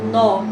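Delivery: "nó" punched loud on its own; strong syllable. Shown as a demonstration. Teacher isolating the strongest syllable, a loud stressed "nó". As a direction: hit "nó" hard as a lone syllable. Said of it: "a sílaba mais forte é".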